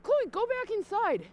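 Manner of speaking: high, loud pleading voice of onlookers; hammed-up bystanders begging her inside; mock scolding people. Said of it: "People are like".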